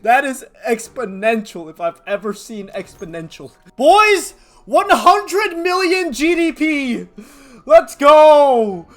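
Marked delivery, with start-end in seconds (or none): 3.8-7.1 loud, high, drawn-out hype; excited shout. Real, not acted. Gamer hyping his boys over GDP, loud triumphant shout. Hyped over game milestone. 7.7-8.9 loud, high, drawn-out hype; excited shout. Real, not acted. Gamer hyping his boys over GDP, loud triumphant shout. Celebrating game progress.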